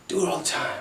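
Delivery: soft, breathy throwaway; whispered aside. Acted. Roasting Kofi, a whispered sly aside.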